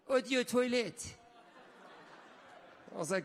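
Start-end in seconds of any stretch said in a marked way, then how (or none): 0.1-1.1 French pronunciation. Retold or imitated. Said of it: "with a bottle of perfume".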